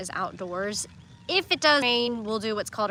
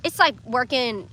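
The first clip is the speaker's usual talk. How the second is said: ordinary delivery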